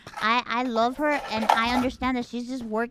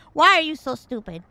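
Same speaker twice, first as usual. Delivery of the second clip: loud, exasperated mock scolding; imitating scolding mom; 3 semitones higher, 8 dB louder than usual. Mom scolding her for crying, voiced.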